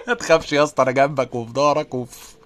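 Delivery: ordinary talk, nothing marked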